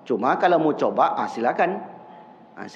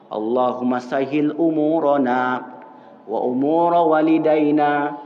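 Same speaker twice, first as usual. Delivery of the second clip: slow, low Arabic chant; prayer recitation; 3 semitones lower, slower than usual. Shown as a demonstration. Preacher recites the pesantren prayer slowly.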